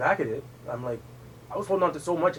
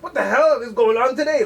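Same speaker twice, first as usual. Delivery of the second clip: higher, louder exasperated outburst; exasperated retelling; 9 semitones higher, 6 dB louder than usual. Retold or imitated. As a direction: retell it as a baffled protest. Retells protesting under family pressure.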